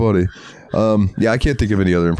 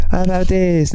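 much higher, silly cartoon voice; high put-on voice; 10 semitones higher than usual. Quoting Stewie trying voices, high and silly. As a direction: squeak it like a cartoon baby.

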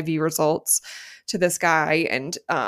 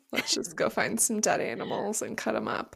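mock casual; 3 semitones higher, 7 dB quieter, faster than usual. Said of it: "Like, super casual".

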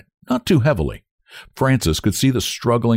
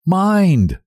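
sudden high, sharp caution; sharp warning; 10 semitones higher, 4 dB louder than usual. A narrator's reading. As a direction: warn her sharply. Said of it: "said Frances sharply".

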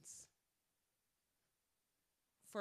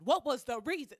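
much louder, higher demand for an explanation; exasperated shout; 7 semitones higher, 14 dB louder than usual. Acted. Confronting a former friend over their actions, exasperated shout. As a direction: yell it at the friend who wronged you.

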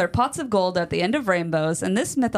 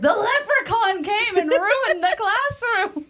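high, slow, mock-distressed teacher; dramatic teacher voice; 13 semitones higher, 3 dB louder, slower than usual. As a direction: wail it like an upset teacher. Teachers announcing to kids.

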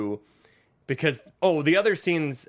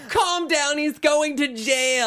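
mimicking the mother; 11 semitones higher, 4 dB louder, slower than usual. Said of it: "S4 line repeated from clip".

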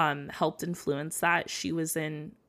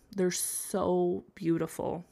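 choked up; slower than usual. Real, not acted. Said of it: "they make me wanna sob".

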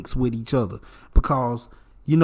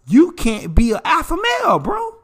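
high, raised-voice protest; heated challenge; 13 semitones higher, 4 dB louder than usual. Real, not acted. Telling a bro he can't be alpha, heated challenge. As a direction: call it out high and loud, incredulous.

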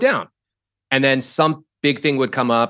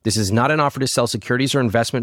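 fast legal disclaimer; 4 dB quieter than usual. Acted.

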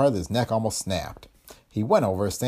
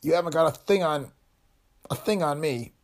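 pained defiance; 8 semitones higher than usual. A narrator's reading. Wounded Foster defying, in pain.

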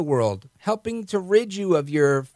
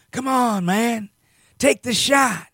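higher-pitched imitation of Biden, a bit hammed-up; mock Biden; 5 semitones higher, slower than usual. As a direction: impersonate an old politician pleading, mildly. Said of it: "He goes out there and goes".

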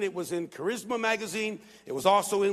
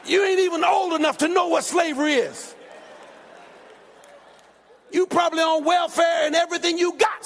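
high, raised scolding; retold angry comeback; 6 semitones higher, 5 dB louder, faster than usual. Preacher retells telling off the young man, heated scolding. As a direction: retell a heated put-down at full voice.

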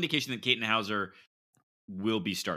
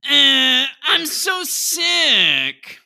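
sick whiny voice; 13 dB louder, much slower than usual. Acted. Cold open sick whiny voice.

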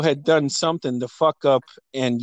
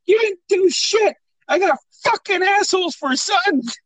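imitating angry mom. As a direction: scold like a worn-out mother, pitched way up. Mom voiced scolding.

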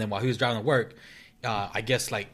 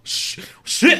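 high, loud panicked gasp of a curse; mock-terrified outburst; imitated panic; 9 dB louder than usual. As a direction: act out a jolt of terror, voice jumping up. Retells friend seeing girl behind him.

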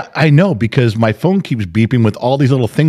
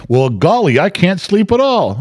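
higher, wide-eyed mock innocence; mocking innocent whine; 8 semitones higher than usual. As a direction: tease her cluelessness.

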